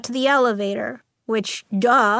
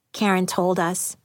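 ordinary delivery, nothing marked

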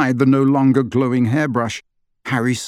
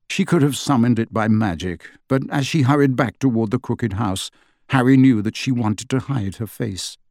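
plain reading voice

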